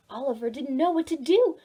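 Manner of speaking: usual speaking voice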